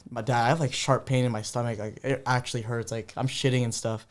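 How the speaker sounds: mock pitiful plea to dad; hushed, hurting little complaint; weak sick voice. Retells complaining of stomach pain.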